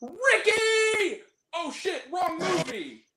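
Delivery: lightly voiced character yelling names; shameless movie character. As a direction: call out like a goofy thug in a film. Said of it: "looks into the camera with no shame and goes".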